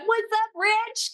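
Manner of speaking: high-pitched, drawn-out mock greeting; exaggerated friendly greeting. Greeting minty-breathed Rich up close at a party, hammed-up high hello. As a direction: sing it out high and slow, hamming up the hello.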